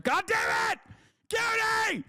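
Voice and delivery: very high, strained screaming; mock rage. Streamer to friend who told the truth, shrieking mock rage. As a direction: screech it in comic despair.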